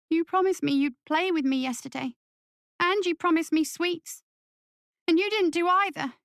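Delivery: put-on high-pitched child complaining to his dad; young boy. Young son reproaching his father.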